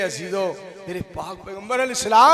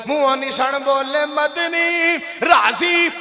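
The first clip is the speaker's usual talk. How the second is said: full preaching; 3 semitones higher, 5 dB louder, slower than usual. Real, not acted. Preacher repeats couplet louder, high.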